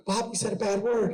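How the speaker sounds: high-pitched imitation of a child; upset little girl. Daughter telling dad about imaginary friend, upset child voice. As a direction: play a small daughter tattling, upset. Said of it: "she came to me crying".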